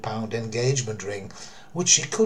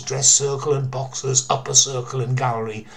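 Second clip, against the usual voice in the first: ordinary delivery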